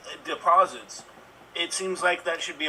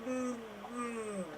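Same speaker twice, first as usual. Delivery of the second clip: mock speechless; 6 semitones higher, 16 dB quieter than usual. Mock speechless then blurts.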